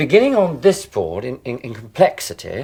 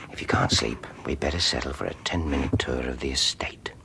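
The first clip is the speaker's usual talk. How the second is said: soft breathy night-time whisper, barely voiced; hushed whisper; 10 semitones lower, 8 dB quieter than usual. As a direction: whisper so as not to wake her. Late night, not waking Mumsy.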